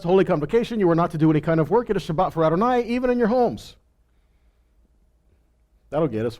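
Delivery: ordinary talk, nothing marked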